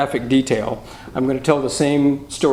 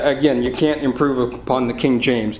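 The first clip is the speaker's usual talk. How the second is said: ordinary talk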